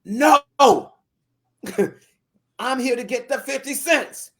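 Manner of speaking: put-on high loud defiant child's yell; yelling back. Little girl yelling back at the landowner, defiant and loud. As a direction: stand your ground and yell it. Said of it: "She yelled right back".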